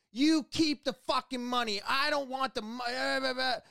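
mock customer tirade, high and heated; retold angry yelling. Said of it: "she starts yelling".

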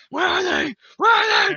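loud, high mock interrogation shout, building; Batman. Vigilante slamming a prisoner's head on the table, shouted impression. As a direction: demand it in a hammed-up movie vigilante yell. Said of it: "Dark Knight where Batman slams Joker's head".

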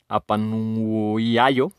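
very slow, drawn-out syllables; laborious sounding-out. Real, not acted. Host finding these pronunciations complicated, laborious sounding-out. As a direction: feel through the word, stretching the middle. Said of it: "complicadas estas pronunciaciones".

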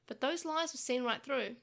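ordinary delivery, nothing marked

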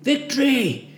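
high exhausted group shout. A narrator's reading. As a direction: yell it back from the mud. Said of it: "we managed to shout".

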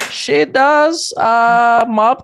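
very high, loud proud voice; bragging mom. Retold or imitated. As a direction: boast about her like a proud mom.

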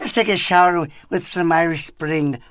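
loud mock Irish advert voice; jokey, exaggerated Irish accent; cartoon Irish. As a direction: ham up a cartoon Irish brogue. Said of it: "they want a real Irish guy".

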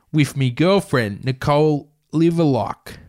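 comic crook giving an alibi; interviewed suspect. Acted. Acts the suspect answering interview questions.

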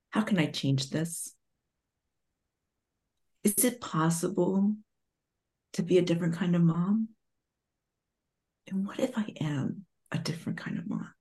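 soft, hushed, ending in a whisper; quiet self-questioning. Retold or imitated. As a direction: ask yourself softly, fading to a whisper. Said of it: "I said".

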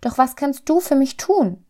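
high, eager little-soul voice; calling little soul. A narrator's reading. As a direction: ask it eagerly, pitched well up. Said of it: "rief die kleine Seele".

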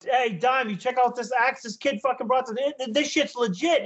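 high, eager retold call; impressed drummer. Drummer telling brother about kid's axe, impressed. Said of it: "He's like that".

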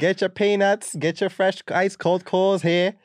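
high, loud, drawn-out hawking; ballpark vendor call. Retold or imitated. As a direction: sell peanuts to the bleachers. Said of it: "sounds like you're at a baseball game".

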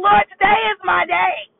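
gushing to herself that today is her day; excited inner voice. Retold or imitated. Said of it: "And I'm thinking".

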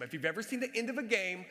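ordinary talk

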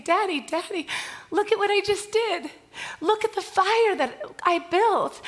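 put-on high, bright child's cheer at the fire; excited little girl. Little girl cheering to her daddy, excited and high. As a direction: voice a thrilled small child calling daddy.

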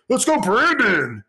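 high, far-off put-on voice for the chant; high put-on voice tossing out the slogan; mocking caller. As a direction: chirp the chant like a smug prank caller. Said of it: "he's just like".

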